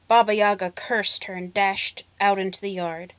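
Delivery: ordinary delivery, nothing marked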